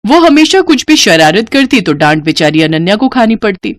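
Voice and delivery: ordinary delivery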